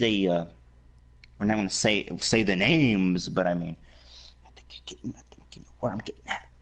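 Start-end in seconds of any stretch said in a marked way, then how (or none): 4.5-6.5 whisper. Real, not acted. Hinting at names, secretive.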